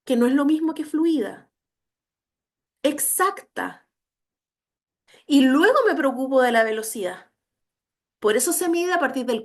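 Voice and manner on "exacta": Exacta drawn out slowly, pitched up, rising at the end; teacher stretching Exacta so it sinks in; slow stressed word. Shown as a demonstration. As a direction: lean on Exacta, slow and high. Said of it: "que la lectura sea exacta".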